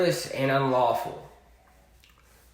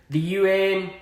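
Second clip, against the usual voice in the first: ordinary talk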